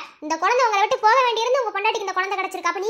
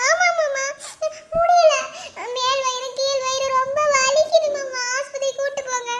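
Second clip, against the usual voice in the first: higher, tearful whine of pain; pained pleading; 4 semitones higher, slower than usual. Acted. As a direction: beg your husband through the pain. Wife pleading with husband in pain. (கணவனிடம் வலியுடன் அழுது கெஞ்சுதல்)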